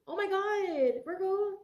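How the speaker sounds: high-pitched, overcome exclamation; real feeling welling up; emotional. Real, not acted. Tarot reader moved by the reading to Virgo, emotional and high-pitched. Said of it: "that's how I'm feeling".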